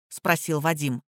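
plain reading voice

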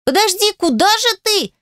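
put-on high, girlish call after someone running off; ringing cry. A narrator's reading. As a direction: call out in a ringing little girl's voice. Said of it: "звонко закричала Адель".

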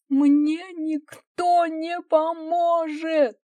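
high, loud, drawn-out cry; despairing wail. A narrator's reading. Wife refuses husband's help, despairing wail. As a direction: wail slowly, all hope gone.

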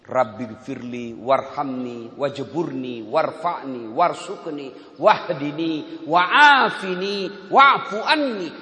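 slow, drawn-out recitation rising at the end; slow recited prayer. Shown as a demonstration. Preacher demonstrating prayer to the congregation, slow recitation. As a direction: recite each word slowly, building. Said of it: "Coba kalau Bapak baca itu".

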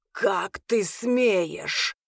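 very slow, drawn-out angry hiss; snake-like hiss. A narrator's reading. Arvin outraged at his cousin's words, hissing. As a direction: hiss each word out like a snake. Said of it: "зашипел Арвин змеёй".

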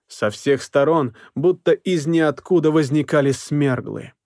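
plain reading voice

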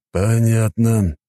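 slow, drawn-out musing; thoughtful. A narrator's reading. Policeman takes in the testimony, slow thoughtful voice. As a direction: stretch the word, thinking it over. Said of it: "задумчиво проговорил полицейский".

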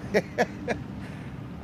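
soft chuckle. Acted. Inspector chuckles.

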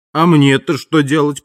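ordinary delivery

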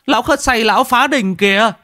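frantic villager calling for help; panicked cry. A narrator's reading. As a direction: cry out in shock at the wrecked shrine. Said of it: "Có người hốt hoảng kêu lên".

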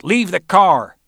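bully calling after the fleeing man. Acted. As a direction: yell it after him down the hall. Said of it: "Roland called out".

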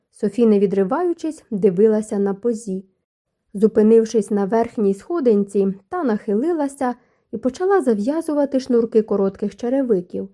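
plain reading voice

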